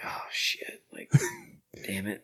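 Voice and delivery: whispered oh no under the breath; whispered curse. Retold or imitated. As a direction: hiss it quietly to yourself. Retold dismay after fight.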